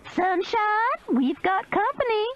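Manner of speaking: high yelled call toward the trailer; screamed call. A narrator's reading. Meemaw calls to the trailer, screaming. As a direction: holler it out to someone inside. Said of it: "turned toward the trailer and screamed".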